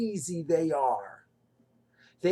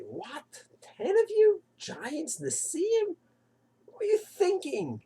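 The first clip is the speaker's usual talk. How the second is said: incredulous; 9 semitones higher, 3 dB quieter, slower than usual. Retold or imitated. Mocking the spies in hindsight.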